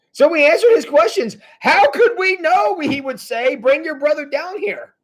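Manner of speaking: high-pitched, defensive protest; protesting brothers. Acted. Brothers answer their father's blame, indignant protest.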